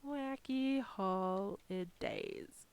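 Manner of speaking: very slow, spelled-out naming; typing it out slowly. Real, not acted. Crafter naming the file while typing, slowly. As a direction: say each word as you type it. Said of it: "I wanna call this".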